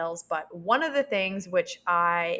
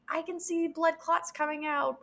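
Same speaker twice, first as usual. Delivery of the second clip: raised, tense quoted voice; quoting the midwife; 9 semitones higher than usual. Retold or imitated. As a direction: voice the worried midwife, pitched up. Retelling midwife's alarmed words.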